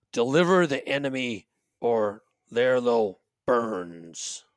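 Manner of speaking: slow, menacing gruff voice on a recording. Acted. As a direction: deliver a gruff ransom threat, drawn out. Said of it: "a recording of, of a gruff voice saying".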